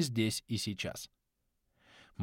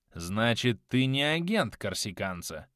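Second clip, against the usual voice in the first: usual speaking voice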